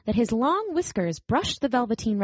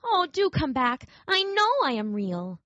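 pleading; 6 semitones higher than usual. Acted. Rabbit pleading alone.

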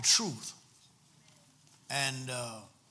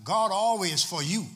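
"God always" punched louder, ending on a rising "you"; emphatic; 3 semitones higher, 6 dB louder than usual. Shown as a demonstration. Preacher driving a point home to the congregation, emphatic. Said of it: "Let me emphasize tonight".

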